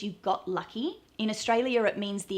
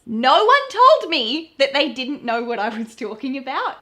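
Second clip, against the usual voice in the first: much louder, laughing at herself; loud amused disbelief; 4 semitones higher, 10 dB louder than usual. Real, not acted.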